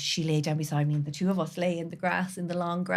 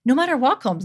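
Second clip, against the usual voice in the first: emphatic; 6 semitones higher, 10 dB louder than usual. Real, not acted. Emphatic repeated declaration.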